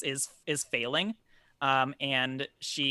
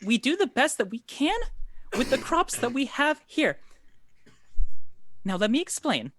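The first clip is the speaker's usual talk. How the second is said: nervous noblewoman explaining; 7 semitones higher, 3 dB louder than usual. Acted. NPC speaking long in-character.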